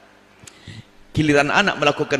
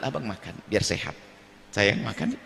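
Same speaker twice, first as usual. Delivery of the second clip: much softer, lower, tender invitation; gentle words; 7 semitones lower, 7 dB quieter than usual. Acted. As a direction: coax your husband to eat softly. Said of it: "dirubah dengan kalimat lembut". (lembut dan pelan, mengajak suami makan dengan sayang)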